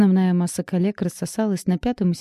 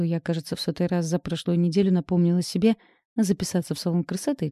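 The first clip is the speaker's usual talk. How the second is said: plain reading voice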